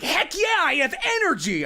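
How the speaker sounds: high, loud, fast burst of energy; overacted excited shout; hammy excitement. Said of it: "the angry or the".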